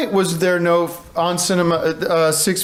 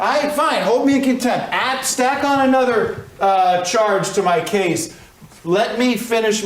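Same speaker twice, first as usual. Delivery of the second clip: angry; 5 semitones higher than usual. Acted. Held in contempt, lashes out.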